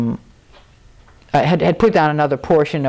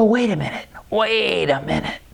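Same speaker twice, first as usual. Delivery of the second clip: slowing down to a halt; softer, dawning second thought; sudden reconsidering; 4 dB quieter than usual. Retold or imitated. Acts out stopping his panic.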